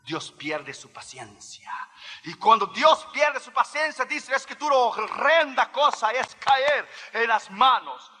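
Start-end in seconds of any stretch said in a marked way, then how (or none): 2.2-8.1 high, emphatic scriptural warning; forceful preaching. Real, not acted. Preacher warns the congregation of God's lost patience, forceful preaching. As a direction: raise the pitch and proclaim it as a warning.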